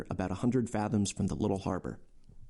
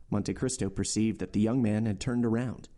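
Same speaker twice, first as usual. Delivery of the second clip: ordinary delivery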